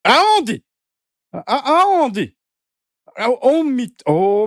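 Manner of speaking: loud, rising-falling cry of disbelief; stretched, incredulous challenge; incredulous outburst. Real, not acted. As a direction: throw the question out in scoffing disbelief. Scoffing at the claim just read.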